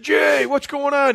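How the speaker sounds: drawn-out high call; high slow greeting. Retold or imitated. Greeting Jay Cutler, retold.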